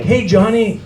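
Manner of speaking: put-on high, excited greeting of an old acquaintance; friendly judge. Judge greets his used-car salesman in court, chummy. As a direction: act out a judge delighted to recognize someone. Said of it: "the judge recognized him and was like".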